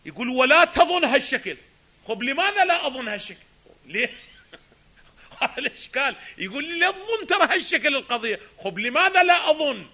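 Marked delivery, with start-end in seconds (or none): 0.3-4.1 challenge. Real, not acted. Challenging the author's claim. 6.8-9.9 challenge. Real, not acted. Challenging the author's claim.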